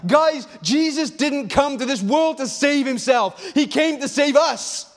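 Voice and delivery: preacher at full force; impassioned preaching. Real, not acted. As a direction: drive the point home to the congregation.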